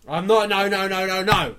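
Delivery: loud, high protesting no's; a burst of loud denials. Real, not acted. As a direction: protest loudly, over and over. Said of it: "keep that quiet".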